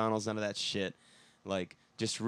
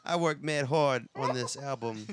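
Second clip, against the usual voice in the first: put-on thick Bronx accent echoing his line; 4 semitones higher than usual. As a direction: repeat it like a guy from the Bronx. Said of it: "in my Bronx accent".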